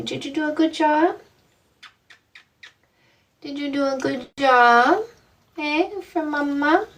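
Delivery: high sing-song praise for a pet. Real, not acted. Host praises her dog in high baby talk. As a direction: coo it up at the dog.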